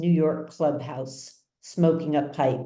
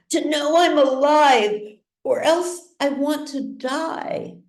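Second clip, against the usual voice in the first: high, louder cry of pain; anguished scream; 9 semitones higher, 4 dB louder than usual. A narrator's reading. Paralysed son screaming to feel anything, high and loud. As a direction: scream it out in despair. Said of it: "he screams".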